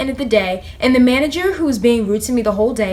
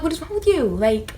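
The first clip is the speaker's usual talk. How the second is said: incredulous look; 3 semitones higher than usual. Retold or imitated. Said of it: "looking at them like".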